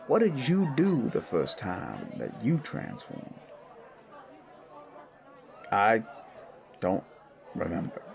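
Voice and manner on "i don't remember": halting reluctance. Acted. Asked about his first transformation, halting and reluctant. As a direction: force it out word by word, haltingly.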